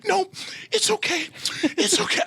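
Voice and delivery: a light imitation of soothing; reassuring. Aniston reassuring the nervous actor, lightly imitated. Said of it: "She's like".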